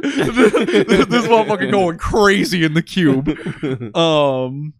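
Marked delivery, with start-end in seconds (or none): 0.0-3.3 high, slow words through real laughter; laughing. Real, not acted. Recapping a character losing it in the cube, laughing. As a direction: crack up while saying it.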